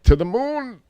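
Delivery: high, rising stretched cry; sky-high exclamation. Shown as a demonstration. Showing how rattled it gets, sky-high exclamation. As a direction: send the pitch soaring on moon. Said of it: "It's like".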